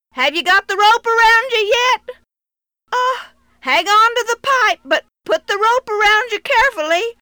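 loud, high anxious call. Acted. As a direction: call down to your son. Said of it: "Dad called again".

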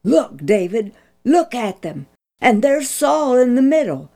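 put-on a young soldier who can hardly contain himself; quivering excitement. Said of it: "Abishai fairly quivered in excitement".

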